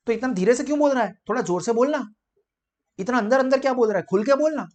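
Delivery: a listener losing patience with mumbling; impatient listener. Retold or imitated. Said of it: "आप ऐसा धीरे-धीरे बोलते हो ना, तो सामने वाला".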